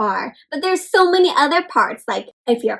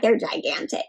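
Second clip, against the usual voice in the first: hushed aside; 5 semitones lower, 7 dB quieter than usual. Real, not acted. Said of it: "aside about huge parents".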